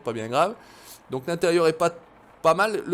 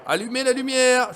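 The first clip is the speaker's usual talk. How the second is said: shouting; 9 semitones higher, 7 dB louder than usual. Pretend. Player lost in the dark, shouting for light. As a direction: yell it out for someone to hear. Repeats call for light louder.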